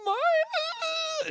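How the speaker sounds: high breaking cry on the name; new father naming his daughter, voice breaking; voice cracking crying. Retold or imitated. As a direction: let the name crack into a sob. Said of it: "started crying".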